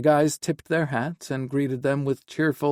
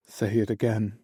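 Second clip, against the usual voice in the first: shaky. A narrator's reading. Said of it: "Kieran said shakily".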